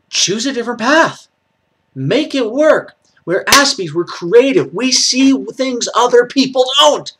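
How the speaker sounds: high, loud rallying cry; impassioned exhortation. Real, not acted. Speaker rallying fellow Aspies to use their strengths, impassioned exhortation.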